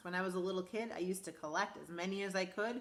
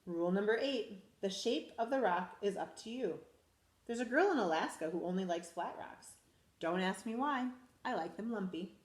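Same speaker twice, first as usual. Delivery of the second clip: lively storybook read-aloud; animated picture-book reading; reading to children. Acted. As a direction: read it to a class of little kids. Teacher reads picture book aloud.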